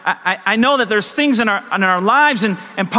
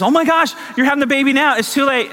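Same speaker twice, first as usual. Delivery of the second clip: alarmed nurse; 8 semitones higher than usual. Retold or imitated. Acted alarmed nurse in birth story.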